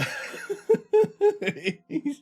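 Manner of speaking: squeaky helpless laugh; high giggle. Real, not acted. Laughing at his hiccups.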